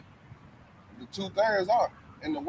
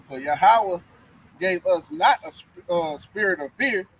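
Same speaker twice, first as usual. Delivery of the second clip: slow, louder preaching read; scripture reading; 7 dB louder than usual. Acted. Reading Second Timothy aloud to the listeners, slow and louder. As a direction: proclaim the verse slowly and loudly.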